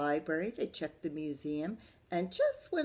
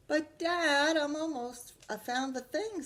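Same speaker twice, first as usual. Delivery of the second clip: put-on high, loud child protest; whiny protest; 10 semitones higher, 5 dB louder than usual. Arthur protesting to Dad at bedtime, high whine. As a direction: whine to stay up.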